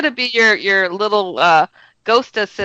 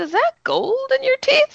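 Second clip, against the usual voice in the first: put-on curious child. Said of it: "I said".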